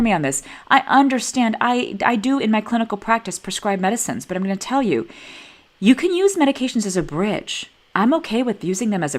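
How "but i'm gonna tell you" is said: normal talking voice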